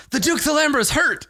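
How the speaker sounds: high alarmed guard; a worried guard shouting. Acted. GM voices guard.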